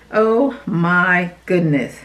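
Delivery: usual speaking voice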